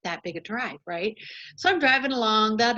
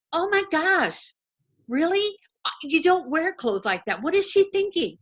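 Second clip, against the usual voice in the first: mock shock; 6 semitones higher than usual. Driver retelling her shocked thought about a passerby, mock scandalized. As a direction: play up being shocked, higher. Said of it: "And I'm like".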